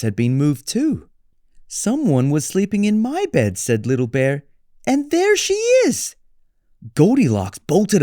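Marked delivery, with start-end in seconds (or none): 1.7-6.1 high cub voice rising to a squeal; little bear. Acted. Little Bear spots Goldilocks in his bed, high squeal. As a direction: squeak higher as you spot her.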